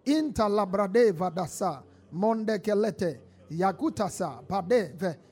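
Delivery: rapid fervent tongues prayer; speaking in tongues. Acted. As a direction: pray in tongues, flowing. Praying in tongues.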